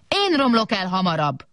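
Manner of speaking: mild boastful whoop, falling from high; big robot's whoop. A narrator's reading. Big robot answering the small one, mild whoop. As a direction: call it out in rivalry, pitch falling. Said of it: "kurjantott a nagy robot".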